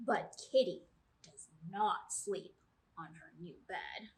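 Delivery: usual speaking voice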